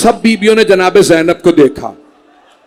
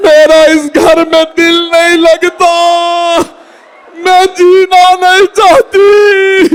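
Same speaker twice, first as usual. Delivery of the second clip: grieving wail; 10 semitones higher, much slower than usual. Acted. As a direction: let the grief build into a cry. Zainab's lament at home.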